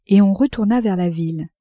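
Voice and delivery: ordinary delivery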